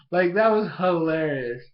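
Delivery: usual speaking voice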